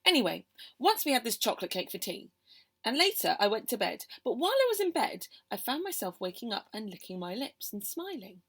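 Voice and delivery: ordinary delivery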